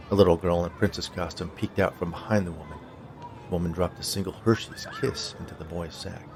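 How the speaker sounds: ordinary delivery, nothing marked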